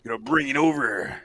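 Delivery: big, drawn-out wrestler holler; Macho Man. Acted. Co-host performs on request for Slim Jim line, wrestler voice. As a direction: open loud like a hyped-up wrestling star. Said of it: "one hell of a Macho Man impersonation".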